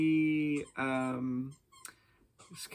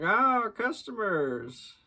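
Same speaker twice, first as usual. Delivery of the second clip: creepy shopkeeper; 8 semitones higher, 5 dB louder, slower than usual. Retold or imitated. Crazy Merlin greets boys.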